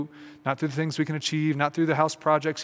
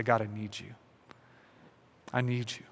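softer, humble plea to God; hushed prayer; 4 semitones lower, 7 dB quieter than usual. Pretend. Preacher voicing the listener's prayer to God, softly pleading. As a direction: let the prayer drop to a softer, intimate murmur.

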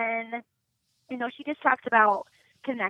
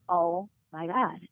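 slow, soft stunned gasp, imitated; retold gasp; 3 semitones lower, 5 dB quieter, slower than usual. Retelling bandmate's reaction on meeting [name], imitated stunned gasp. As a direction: mimic an awed gasp, softly drawn out. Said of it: "I just gasped".

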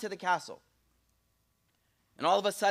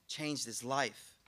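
slower, softer repeat of Changed his life; slow soft echo; 6 semitones lower, 10 dB quieter, much slower than usual. Shown as a demonstration. Preacher echoing the lesson for the crowd, slow and soft. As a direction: let Changed his life linger softly. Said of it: "and changed his life".

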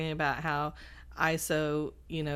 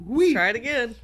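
giggly; 6 semitones higher, 7 dB louder than usual. Real, not acted. Wanting a restart, still giggly.

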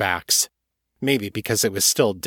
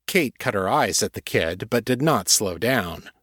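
ordinary delivery, nothing marked

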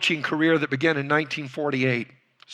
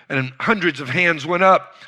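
usual speaking voice

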